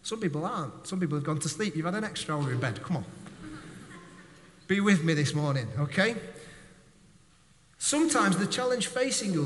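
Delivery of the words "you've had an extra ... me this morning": normal talking voice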